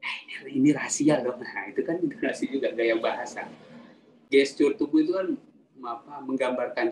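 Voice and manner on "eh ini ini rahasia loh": hushed whisper opening into voiced confiding; secretive intonation. Shown as a demonstration. Speaker showing students how intonation works, secretive whisper. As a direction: demonstrate letting someone in on a secret. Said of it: "Itu, itu intonasi".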